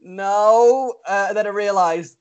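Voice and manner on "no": mock firm no. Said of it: "And I was like".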